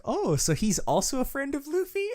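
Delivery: high, giggly princess voice; Shirahoshi voice. Retold or imitated. Fan voicing Shirahoshi's naive high question, laughing. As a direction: squeak it like a naive sweet princess.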